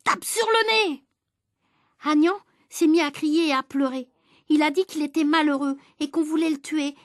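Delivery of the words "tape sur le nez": egging on shout. Acted. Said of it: "a crié Eudes".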